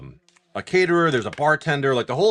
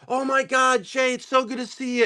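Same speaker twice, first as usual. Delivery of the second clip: high-pitched tipsy gush; gushing cousin; 11 semitones higher than usual. Retold or imitated. Drunk teenage cousin greets him at the wedding, gushing. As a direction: imitate a cousin's over-delighted greeting.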